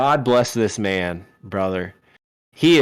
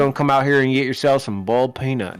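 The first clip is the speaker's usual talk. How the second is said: Southern announcer; 2 semitones higher, faster than usual. Retold or imitated. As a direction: hawk boiled peanuts like a small-town Southern announcer, only a hint of the accent. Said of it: "did you channel the guy that we heard, the announcer".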